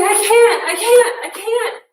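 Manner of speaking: mother mimicking her son's frustration; frustrated child. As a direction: whine it like a kid giving up. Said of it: "he kept saying".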